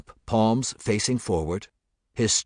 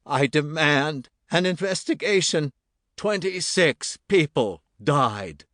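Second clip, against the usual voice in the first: father's voice; 6 semitones higher than usual. A narrator's reading. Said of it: "It was his father's voice".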